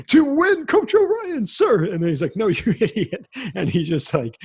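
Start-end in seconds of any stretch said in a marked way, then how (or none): 0.1-1.8 very high, loud eager-recruit shout; eager suck-up. Retold or imitated. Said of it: "suck up to him". 2.4-3.3 higher-pitched retold put-down; mock scornful put-down. Coach shredding the suck-up player, retold.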